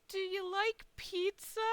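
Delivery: high, slow, caring question; kindly concern. Retold or imitated. Front desk lady offering upset guest food, high caring voice. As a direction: ask tenderly, stretching it out high.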